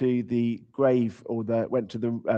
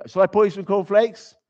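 breakfast offer; 8 semitones higher, 4 dB louder than usual. Pretend. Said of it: "You know".

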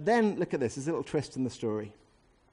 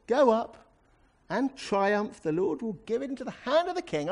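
high, over-bright mock enthusiasm; very sarcastic; 9 semitones higher, 5 dB louder than usual. Shown as a demonstration. Prophet humouring the king with a false promise, very sarcastic. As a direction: gush it with obvious sarcasm, pitched high. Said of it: "must have said it very sarcastically".

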